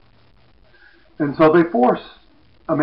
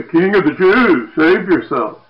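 loud sneering call, slow and higher on king; soldiers' mocking shout; 5 semitones higher, 9 dB louder than usual. Soldiers taunting Jesus with vinegar, loud mocking call. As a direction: jeer it like a bored soldier. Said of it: "The soldiers mock him".

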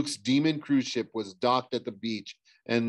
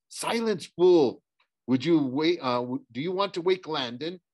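high-pitched, indignant rebuke; sharp scolding; 5 semitones higher than usual. Acted. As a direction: cut off a noisy companion crossly. Zoe scolds narrator for shouting.

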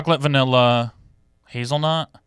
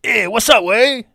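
higher, slow put-on Mexican accent; 7 semitones higher, slower than usual. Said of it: "do a Mexican accent".

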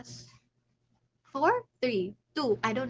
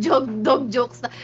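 ordinary talk

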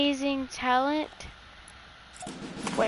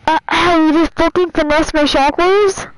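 loud indignant shout; loud playful outrage at a teammate; outraged yell; 3 semitones higher, 13 dB louder than usual. Real, not acted.

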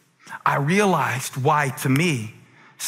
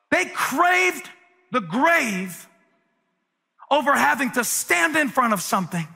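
preaching at full force; 11 semitones higher than usual. Acted. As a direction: whoop it up like a revival preacher.

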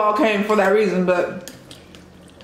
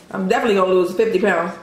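normal talking voice

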